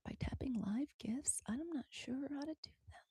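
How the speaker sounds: whispering to herself; whispered. Real, not acted. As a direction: read it under her breath. Reading on-screen instructions quietly.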